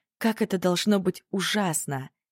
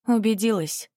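upset grumble; 5 semitones higher than usual. A narrator's reading. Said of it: "расстроенно пробурчала девочка".

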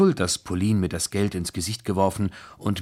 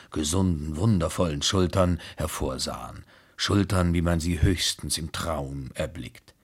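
plain reading voice

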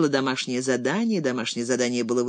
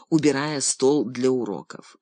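usual speaking voice

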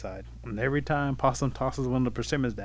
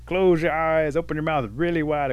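raised storybook possum voice; called-down instructions; 5 semitones higher, 6 dB louder than usual. Acted. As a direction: call instructions down to a friend below.

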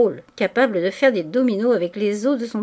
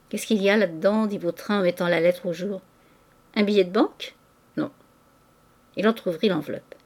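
ordinary delivery

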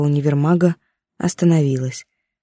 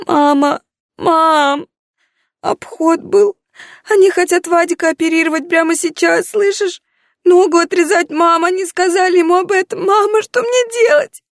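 high, frantic and near tears; a scared girl begging her mother for help; panicked daughter voice; 14 semitones higher, 3 dB louder than usual. Acted.

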